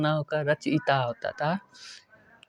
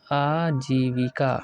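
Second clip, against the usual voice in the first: slow clear reading; much slower than usual. Shown as a demonstration. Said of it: "इसका शुद्ध रूप हो जाएगा".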